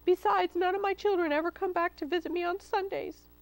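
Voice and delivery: high-pitched, pleading mother's protest; distressed mother crying. A narrator's reading. Mama Pig tells Hamlet she's too young to leave, crying. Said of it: "Mama Pig cried".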